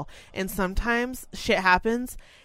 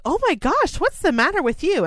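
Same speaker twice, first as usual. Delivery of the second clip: high, louder worried voice; concerned elderly coworker; 10 semitones higher, 3 dB louder than usual. Retold or imitated. An elderly coworker finding her crying, high and worried. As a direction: fuss over her like a startled grandma. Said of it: "they were like".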